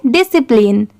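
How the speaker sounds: Discipline quickly as one joined word; brisk, blended discipline; said at speed. Shown as a demonstration. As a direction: run discipline together without breaks. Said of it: "इसे speed में पढ़ेंगे".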